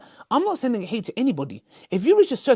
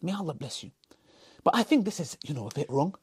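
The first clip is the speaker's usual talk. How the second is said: gentle advice; 5 semitones lower, 8 dB quieter than usual. Retold or imitated. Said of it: "they were telling you".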